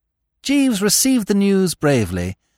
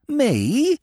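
pitched up, mock astonished; higher mock outrage; 7 semitones higher than usual. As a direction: play the wronged innocent. Steggles feigns innocence, second take.

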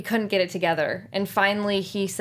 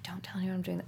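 much softer, hushed secret aside; whispering; 11 dB quieter, faster than usual. Retold or imitated. Actress retells crew member's secret gift, whispered. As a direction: lean in and whisper it.

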